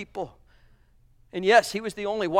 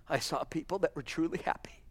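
whisper; 3 semitones lower, 12 dB quieter than usual. Real, not acted. Father's testimony, hushed.

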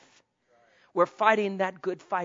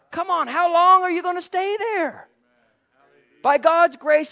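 loud, high preaching call; fervent pulpit cry; 10 semitones higher, 8 dB louder than usual. Real, not acted. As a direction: rouse the congregation. Preaching at full force.

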